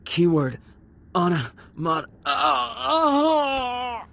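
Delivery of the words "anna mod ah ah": high strained cries of pain; wounded groans rising high. Acted. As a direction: cry out, hurting badly. Pained cries.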